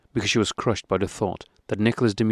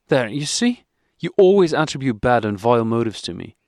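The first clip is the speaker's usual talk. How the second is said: tearful anger; 4 semitones higher, 5 dB louder than usual. A narrator's reading. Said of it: "with tears of anger and resentment".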